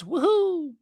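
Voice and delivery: very high, excited cheer; squealing woohoo; gleeful whoop. Real, not acted. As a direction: whoop for joy as the price hits the buy level. Price hits weekly buy level.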